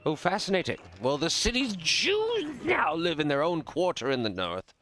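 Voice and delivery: high, animated nobleman voice; worldly lord. Acted. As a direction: gossip like a well-travelled aristocrat. Voices the lord describing Venice.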